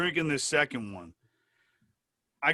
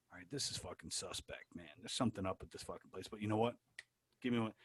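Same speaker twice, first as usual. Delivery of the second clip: low, very soft mutter to yourself; wary thought under the breath; suspicious aside; 6 semitones lower, 15 dB quieter, faster than usual. Retold or imitated. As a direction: murmur it low, half to yourself. Muttered thought on a suspect bar.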